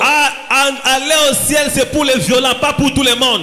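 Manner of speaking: a revival preacher in full flight; preaching at full force. Real, not acted. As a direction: drive it home to the congregation. Preacher's climax on heaven.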